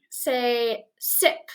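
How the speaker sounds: ordinary talk, nothing marked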